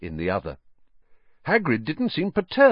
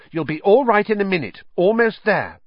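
put-on higher, brisk girl's voice; a higher, louder girl's encouragement; encouraging Hermione; 10 semitones higher, 5 dB louder than usual. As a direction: urge him on, nearly there. Hermione reassuring Ron.

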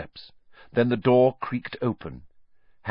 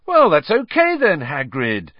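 loud, much higher relieved burst; boy cheering up a sobbing friend; 7 semitones higher, 8 dB louder than usual. Acted. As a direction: brighten up to comfort him. Ron cheering Hagrid up.

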